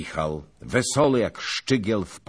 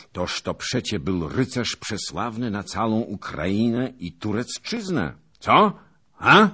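smug, loud self-satisfied boast; fishing for praise, delighted; pleased with himself; 7 semitones higher, 6 dB louder than usual. A narrator's reading. Zagłoba boasting of slaying Burłaj, smug and loud. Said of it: "rzekł z zadowoleniem".

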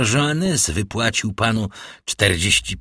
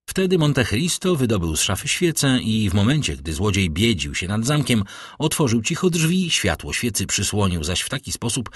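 ordinary delivery, nothing marked

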